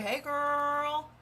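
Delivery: high, drawn-out fake-friendly call; mock an over-eager seller, high and stretched; gushy salesy greeting. Consultant mocking how sellers greet friends online, fake gushy. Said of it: "walk up to your friends in real life and be like".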